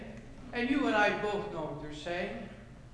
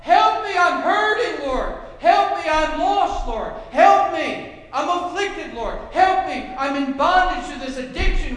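high, loud desperate pleading cries; crying out to the Lord; 6 semitones higher, 11 dB louder than usual. Acted. Preacher voicing the afflicted crying to the Lord, loud pleading. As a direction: beg the Lord loudly, over and over. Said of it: "Crying out for help?".

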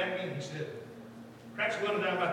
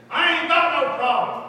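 louder, higher imitation of an angry man; mad retort; 8 semitones higher, 12 dB louder than usual. Preacher re-enacting the driver's first reaction, mad retort. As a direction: throw it back as an indignant mock denial. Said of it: "mad, he said".

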